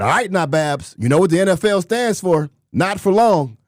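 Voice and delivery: high, loud imitated warning; equipment manager's warning. Equipment manager warning a new player, high-pitched impression. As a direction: put on a high, emphatic voice for the comeback.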